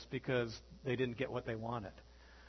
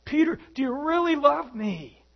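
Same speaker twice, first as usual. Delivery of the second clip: higher, louder, stressing really; Jesus asking Peter a third time, higher and louder; insistent Jesus; 10 semitones higher, 7 dB louder than usual. Retold or imitated. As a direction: lean hard on really. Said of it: "Third time".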